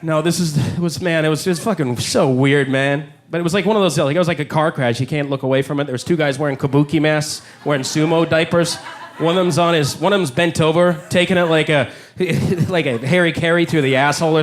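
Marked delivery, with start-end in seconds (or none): none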